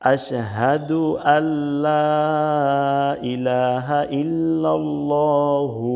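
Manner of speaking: long drawn-out syllables, measured recitation; slow Quranic recitation. Shown as a demonstration. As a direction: recite it slowly, stretching every long vowel. (ধীরে টেনে টেনে তিলাওয়াত)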